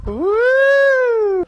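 high, loud whoop of joy; excited whoop. Real, not acted. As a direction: cheer it out. Excited about cooler weather.

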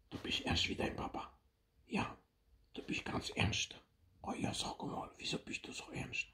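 hushed tender whisper. Real, not acted. Grandparent whispering to baby.

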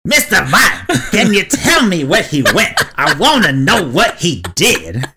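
high, laughing put-on Pikachu voice; Pikachu as Chris Rock. Joking about a Chris Rock Pikachu, high laughing put-on voice. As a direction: ham up a cartoon detective through laughter. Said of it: "Pikachu would have like".

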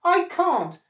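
high, loud horrified protest; appalled cry. A narrator's reading. Crocodile refusing his wife's demand, appalled cry. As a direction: cry out in dismay. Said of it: "quite appalled".

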